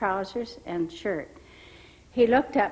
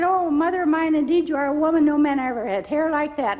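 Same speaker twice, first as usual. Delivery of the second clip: high, louder and fast in wonder; amazed exclamation; 7 semitones higher, 6 dB louder, faster than usual. Retold or imitated. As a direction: marvel at her hair. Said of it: "He said".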